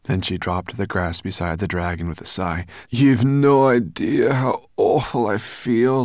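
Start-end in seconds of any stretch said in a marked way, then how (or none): 2.9-6.0 loud, high, drawn-out complaint; groaning. A narrator's reading. Sorrel dropping beside the dragon after the boat, queasy groan. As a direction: groan it out slowly, feeling sick. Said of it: "she groaned".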